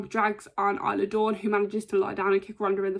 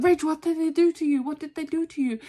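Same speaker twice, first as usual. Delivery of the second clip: higher-pitched concerned Sheamus voice; a worried mock Sheamus, pitched up; imitating Sheamus; 7 semitones higher than usual. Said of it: "And Sheamus comes in and he's like".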